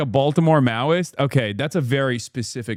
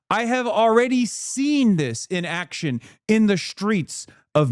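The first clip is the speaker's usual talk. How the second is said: slow, high-pitched rallying oratory; mock preaching; 5 semitones higher than usual. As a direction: proclaim it like a prophecy, drawn out.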